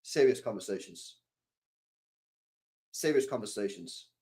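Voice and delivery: low, soft and grave; mock serious. Streamer announcing serious talk to chat, mock grave. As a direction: play up the solemn tone. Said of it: "let's be serious".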